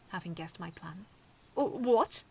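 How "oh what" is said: loud, higher startled "what"; be caught off guard; loud startled outburst; surprised exclamation. Acted. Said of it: "Seaslug exclaimed".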